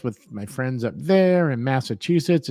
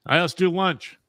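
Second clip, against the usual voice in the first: higher, put-on Hollywood schmoozer; mock Hollywood type; 5 semitones higher, slower than usual. Teasing the co-host as all Hollywood, mock schmoozer. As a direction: mimic a showbiz lunch invitation. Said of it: "He's trying to be all Hollywood".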